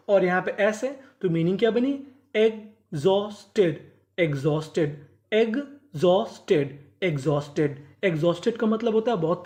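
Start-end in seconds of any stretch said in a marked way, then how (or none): 2.4-3.8 exhausted split slowly as egg-Jos-Ted; slow teaching breakdown of exhausted; syllable by syllable. Shown as a demonstration. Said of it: "टुकड़ों में तोड़ तोड़ के पढ़ें". 5.3-6.8 exhausted split slowly as egg-Jos-Ted; slow teaching breakdown of exhausted; syllable by syllable. Shown as a demonstration. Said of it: "टुकड़ों में तोड़ तोड़ के पढ़ें".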